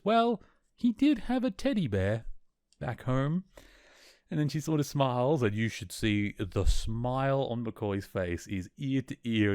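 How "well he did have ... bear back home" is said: light, much higher voice of a smiling mother; mother teasing about her son's teddy bear, lightly voiced, smiling. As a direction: play the fond mother letting a secret slip. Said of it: "his mother smiles and says".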